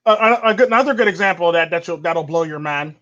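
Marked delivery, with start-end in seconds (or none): none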